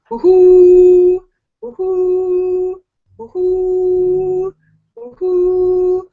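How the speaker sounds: loud, high whooping calls; nerd call woohoos. Shown as a demonstration. Calling out to her viewers with loud woohoos. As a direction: whoop it out to the fans. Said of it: "Here goes".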